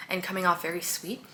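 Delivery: ordinary delivery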